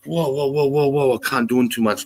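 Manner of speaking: mock-protest, trying to halt it; retold mild alarm. Man retelling visuals overwhelming him, mildly alarmed.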